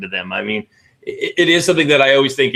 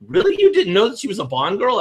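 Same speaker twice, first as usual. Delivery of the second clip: imitating his wife, much higher on "Really"; wife teasing him for not knowing, higher incredulous voice; mock disbelief; 9 semitones higher than usual. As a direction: squeak out the disbelief, then settle. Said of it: "my wife was like".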